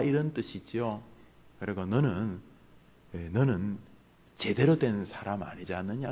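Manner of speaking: normal talking voice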